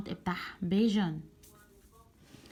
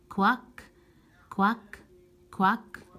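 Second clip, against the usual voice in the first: bright rising quack quacks; duck quack; 4 dB louder than usual. Shown as a demonstration. Duck sound for kids.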